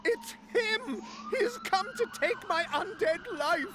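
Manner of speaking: high, loud, frightened cry; panicked shriek. Acted. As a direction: shriek in terror at the intruder. Undead head cries in panic.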